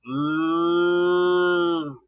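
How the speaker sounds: cow moo. Pretend. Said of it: "What sound do they make".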